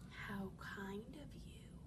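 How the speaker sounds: whispering wolf. Acted.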